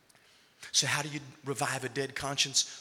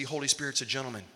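normal talking voice

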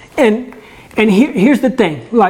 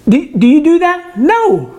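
rhetorical disbelief; 8 semitones higher than usual. Real, not acted. Questioning the congregation.

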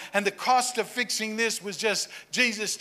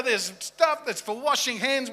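ordinary talk

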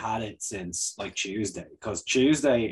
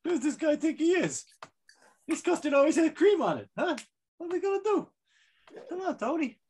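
much higher, hammed-up gangster voice; mafia voice. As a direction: play a wiseguy mobster, pitched way up. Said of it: "I have mistaken the English upper crust with, um, the mafia".

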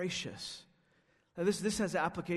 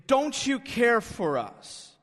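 slow, loud and demanding; presumptuous; 4 semitones higher, 6 dB louder, slower than usual. Retold or imitated. Disciples challenging Jesus in the storm, presumptuous. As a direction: throw the question out like a presumptuous demand. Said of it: "And they say presumptuously".

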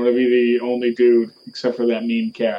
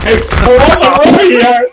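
high, loud and slow mock outrage; loud mock alarm; 11 semitones higher, 10 dB louder, much slower than usual. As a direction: shout it like a startled cop. Mock alarm over buzzing.

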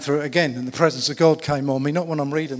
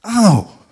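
sudden loud, high "ow"; acted pain cry; 7 semitones higher, 13 dB louder than usual. Preacher acting out a childhood stone hit, mock pain cry.

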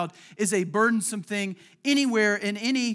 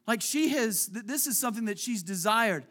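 normal talking voice

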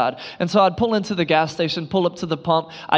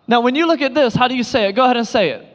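ordinary talk

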